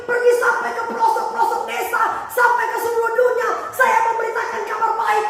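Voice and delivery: high, indignant complaint with shouted peaks; protesting pastor. Acted.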